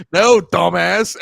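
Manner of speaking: loud, high, drawn-out retort; mock exasperation. Answering clueless strategists about voters, loud mock exasperation. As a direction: shout down a fool's assumption.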